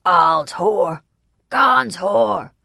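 loud, enraged heckler; furious, forceful jeer; rageful shout. A narrator's reading. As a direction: shout it as an insult across a crowd. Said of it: "A man near us shouted ragefully".

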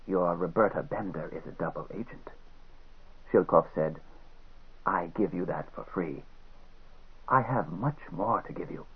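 soft, low menacing whisper of a villain; quiet sinister spy. Acted. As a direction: hush it like a cold defector. Villain speaks softly throughout.

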